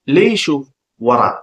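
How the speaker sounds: slow, spaced-out teaching pronunciation; slow demonstration for repeating; slow model. Shown as a demonstration. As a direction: separate the words slowly for learners. Teacher models phrase slowly first.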